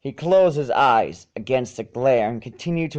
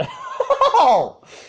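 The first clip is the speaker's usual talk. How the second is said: sudden high burst of laughter; 4 dB louder than usual. Real, not acted. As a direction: break into a squeaky laugh. Reader laughs at herself.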